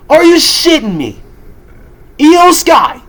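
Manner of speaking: high, loud disbelieving outburst; are you kidding me, yelled high; outraged. Real, not acted. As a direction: shout it at the company in disbelief. Outraged rant at WWE booking.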